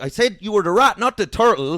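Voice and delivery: high, loud scolding yell at granny; exasperated shout. Retold or imitated. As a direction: yell it in mock frustration. Scolding granny for playing turtle.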